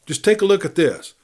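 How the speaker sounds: a prospector eagerly showing off his find. Acted. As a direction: push the ore at him, keen to impress.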